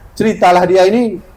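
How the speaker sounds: usual speaking voice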